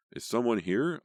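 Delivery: full shout over cats and dogs; shouting at top of voice. A narrator's reading. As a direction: yell it over the barking. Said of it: "I shouted at the top of my voice".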